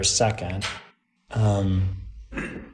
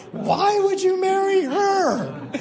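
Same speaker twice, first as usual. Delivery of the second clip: much higher, slow, laughing shouted question; slowly laughing out the punchline, much higher; slower than usual. Real, not acted.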